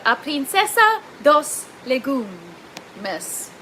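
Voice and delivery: slow, drawn-out mock Spanish accent; hammed-up Spanish accent, stretched out; butchered Spanish accent. Shown as a demonstration. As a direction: deliberately put on a bad Spanish accent, very slowly. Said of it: "say that with a Spanish accent and butcher it".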